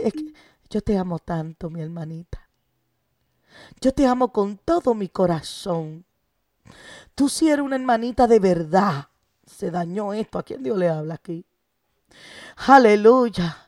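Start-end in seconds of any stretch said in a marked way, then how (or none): 0.0-9.1 soft, much quieter coaxing lover's voice; a hushed, honeyed mock suitor; sweet-talking suitor. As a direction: whisper sweet nothings like a false lover. Voicing the lover who claims love.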